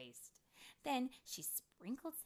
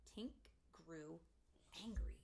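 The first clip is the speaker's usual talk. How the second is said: usual speaking voice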